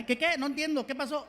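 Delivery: high, baffled "qué, qué" voice; confused Spanish speaker. Acted. Lost Spanish speaker answers English greeting, high and confused.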